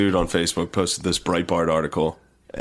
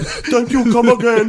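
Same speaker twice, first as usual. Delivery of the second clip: mock high voice; 14 semitones higher, 5 dB louder, slower than usual. Mocking politician thanking Nancy.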